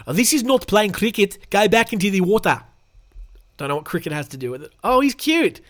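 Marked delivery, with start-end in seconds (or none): 0.0-2.6 loud, raised South African voice; South African accent. Acted. Picnicker chastising the crocodile, South African accent. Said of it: "Let's do a South African voice".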